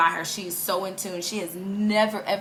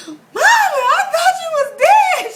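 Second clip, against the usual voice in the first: very high, loud mock bawling; re-enacted tearful wail; 9 dB louder than usual. Daughter finally reaching mother by phone, acted hysterical wail. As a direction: blurt it out in hammed-up hysterics.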